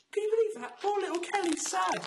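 high-pitched, hammed-up cooing sympathy; mock pity. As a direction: feign heartbreak in a high little voice. Mock sympathy for news item.

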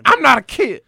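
high, loud indignant coach; Mike Gundy rant. Retold or imitated. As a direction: yell it like a defiant coach. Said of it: "Mike Gundy".

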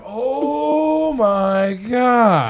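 loud, high, long-stretched dismay; retold shock. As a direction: draw out your horror, hammed-up. Retold shock at racist remarks.

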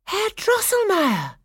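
very high, loud, drawn-out greeting; delighted cry. A narrator's reading. Clara jumping up to greet her godfather, delighted cry. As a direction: cry the name out joyfully. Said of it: "cried Clara".

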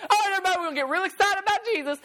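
high, rushed hype; mock excitement. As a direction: parody an over-excited churchgoer, fast and high. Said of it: "everybody starts getting excited".

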